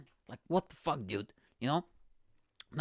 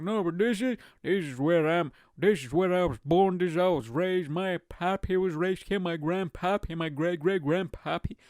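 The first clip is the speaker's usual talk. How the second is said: put-on small-town American, insistent; hammed-up homesteader listing the family roots; stubborn rural local; 3 semitones higher than usual. Local refusing to leave tornado country, mock protesting.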